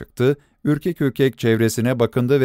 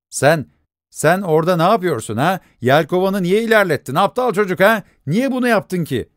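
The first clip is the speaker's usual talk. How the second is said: scolding father; 7 semitones higher than usual. Acted. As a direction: catch the boy red-handed and tell him off.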